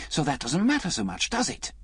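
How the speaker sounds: mildly excited, rising high at the end; lightly eager, questioning upward. A narrator's reading. Liz pressing Alec, mildly excited. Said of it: "she added excitedly".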